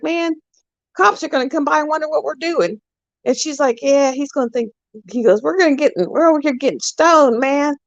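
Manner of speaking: husband's panicked quote. Retold or imitated. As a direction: squeak it out like a man afraid of the cops.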